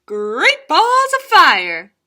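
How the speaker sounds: high, loud, slow cowboy exclamation; a goose hollering like a cowboy, drawn out; cowboy goose exclaiming. Acted. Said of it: "The goose said".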